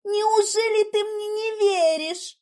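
high, slow tearful wail; hurt, near tears. A narrator's reading. Heroine begs Igor to believe her, near tears. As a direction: plead as if about to cry. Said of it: "От обиды я чуть не заплакала".

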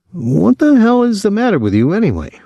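high-pitched, exasperated outburst dropping at the end; fed-up, high-voiced reproach; angry exasperation. A narrator's reading. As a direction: demand it in angry frustration, pitch soaring. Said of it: "He couldn't help being angry".

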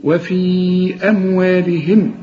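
وفي أموالهم with the long a held for four beats; four-beat madd. Shown as a demonstration. Teacher demonstrates the long vowel, slow recitation. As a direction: stretch the a in أموالهم. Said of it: "هنمد عند الوصل".

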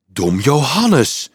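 drawn-out cry of shocked realisation; aghast, stretching out the name; aghast exclamation. Acted. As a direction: exclaim the name slowly, in dismay. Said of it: "riep Frank ontzet".

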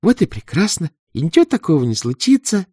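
put-on high, peaceable girl voice; smooth it over, brightly. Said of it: "миролюбиво заговорила Вава".

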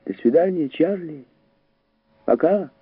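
lightly voiced high boyish call; a hint of a boy hailing a friend; boy calling goodbye. A narrator's reading. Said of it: "снова крикнул мальчик".

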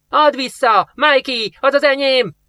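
put-on high, loud child's shriek; a kid screeching after his stolen toy; squealing child. Kid yelling at Mikey to give it back, shrill squeal. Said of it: "két kiskölyök rohant ki visítva".

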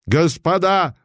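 high, sharp command to his men; commanding shout. Acted. Pretender calling his armed retinue, commanding shout. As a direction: bark the order to the retinue. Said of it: "властно крикнул он своей свите".

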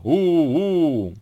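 high, drawn-out "oo" like an engine; shouted motor hum. A narrator's reading. Donkey playing a plane motor, high hooting cry. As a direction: hoot like a little toy motor. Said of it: "ходил с самого утра и кричал".

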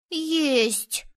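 put-on high boyish insistence; stubborn boy. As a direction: insist like a contradicted kid. Boys quarrel about ghosts. (упрямый мальчишка)

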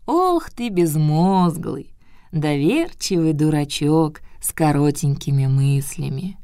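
put-on slow, sighing old scold; old turtle scolding. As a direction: drag out the scolding sigh. Turtle repeats her scolding.

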